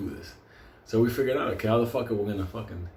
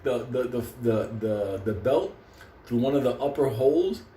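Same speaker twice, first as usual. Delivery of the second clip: normal talking voice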